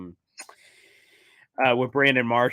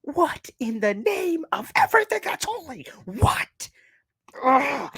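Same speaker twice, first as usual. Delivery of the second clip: mock outrage; slower than usual. Mock outrage after the clip.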